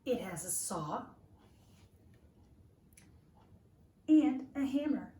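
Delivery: lively, expressive picture-book reading; reading to children. Acted. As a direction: read it like a storybook to little listeners.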